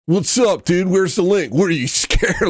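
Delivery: goofy high bro taunt; mocking annoying chatter. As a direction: mimic the obnoxious viewer, sneering. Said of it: "Just show up like".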